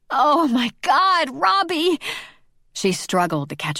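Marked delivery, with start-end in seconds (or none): 0.1-2.0 loud, high cry of release. A narrator's reading. Olivia crying out Robbie's name at her climax, loud and high. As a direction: let it burst out high and loud. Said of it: "as she cried out".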